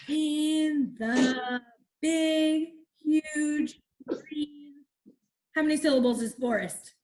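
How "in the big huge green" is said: very slow, each syllable spaced out for counting; syllable-counting read. Shown as a demonstration. Teacher reading a haiku to the class, very slow syllable beats. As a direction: tap out every syllable on your fingers. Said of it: "Let's do the second line together".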